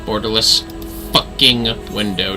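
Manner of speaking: ordinary talk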